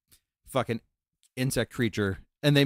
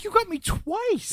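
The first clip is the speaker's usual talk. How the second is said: very high-pitched, incredulous wife voice; imitating amused wife; slower than usual. Wife laughing at being pranked twice, high imitated disbelief. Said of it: "she is laughing".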